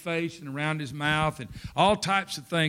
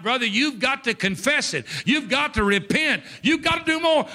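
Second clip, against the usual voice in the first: raised, pitched-up preacher's exhortation; preaching; 6 semitones higher than usual. Acted. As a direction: drive the point home to the congregation. Sermon at full force.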